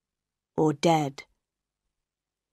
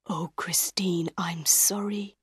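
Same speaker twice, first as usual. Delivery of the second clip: faint, breath-soft apology; dying man's thoughts; 7 dB quieter than usual. A narrator's reading. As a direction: think it aloud with failing strength, very softly.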